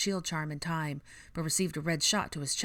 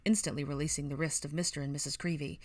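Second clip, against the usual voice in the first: ordinary delivery, nothing marked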